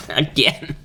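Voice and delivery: coughing through the words; choking and coughing between words. Real, not acted. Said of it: "I'm choking on".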